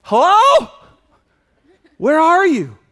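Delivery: high, loud plaintive call; calling out to heaven. Retold or imitated. Believer calling out to a silent God, high plaintive. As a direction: shout into the void. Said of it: "you're like".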